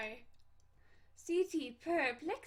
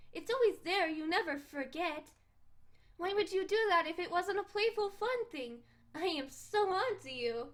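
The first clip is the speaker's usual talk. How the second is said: high, sing-song teasing; teasing cat girl; 4 semitones higher than usual. Acted. Cat girl teasing him about his bow and arrow, smug. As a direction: needle him like a smug kitten.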